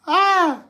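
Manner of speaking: high, held exclamation at a laugh's end; high-pitched laughing sigh; high "ah" after laughing. Real, not acted. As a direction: let out a high cry as the laughter ends. Recovering from laughing fit.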